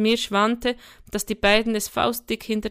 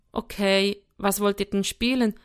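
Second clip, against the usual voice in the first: plain reading voice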